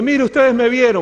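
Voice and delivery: loud, high appeal to a crowd; acted-out triumphant witness; excited testimony. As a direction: call everyone to look. Healed man testifies to congregation.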